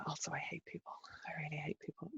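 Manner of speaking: a dry whisper under the breath; a fed-up whisper to the viewer; whispered aside. Real, not acted. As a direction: confide it in a whisper. Whispered aside after a sigh.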